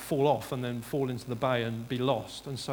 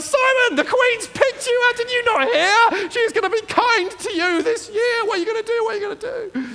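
hysterical high-pitched mock squeal; excited caller; 13 dB louder than usual. Friend phoning Simon about the Queen's choice, wildly excited. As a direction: shriek it loudly, stretching the words out. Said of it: "you would have been on the phone like a shot".

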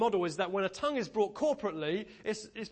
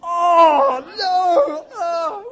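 loud high wail of dismay; loud high anguished no; mock horrified cry; 11 semitones higher, 19 dB louder, much slower than usual. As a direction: cry out in horror as if dying inside. Group horror at being asked to sing.

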